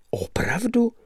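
breathless whispered "Opravdu"; astonished whisper. Acted. The narrator, shocked by Lebeda's news, whispering. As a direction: whisper it in disbelief.

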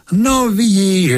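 high, slow, delighted praise; proud of the pupil who beat you; high drawn-out approving cheer; pleased praise. Acted. Teacher proud of beaten pupil.

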